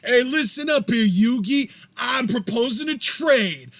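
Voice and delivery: put-on Brooklyn accent, slow and emphatic; Joey Wheeler. Joey proposing a card trade to Yugi, thick Brooklyn accent. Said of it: "my Joey Wheeler accent".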